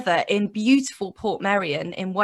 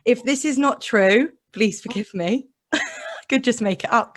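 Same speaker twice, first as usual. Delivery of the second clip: ordinary talk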